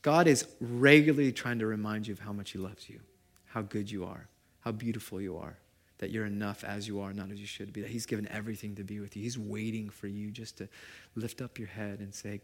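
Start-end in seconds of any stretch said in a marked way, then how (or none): none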